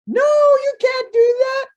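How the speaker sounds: very high, loud shrieked refusal; man begging her not to cut him; panicked. Acted. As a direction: shriek no in terror. Man refuses being cut free.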